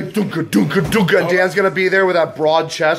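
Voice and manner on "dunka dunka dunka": loud, rhythmic imitated bass thump; thumping club beat. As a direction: pound it out like dance music. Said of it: "it's gonna be like the thumping".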